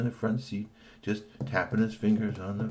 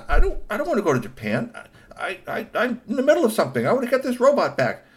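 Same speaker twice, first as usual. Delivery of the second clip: high, loud urgent objecting; protest, rushing, he's busy; pleading protest; 14 semitones higher, 9 dB louder, faster than usual. Acted. Kashikoi protests to uncle.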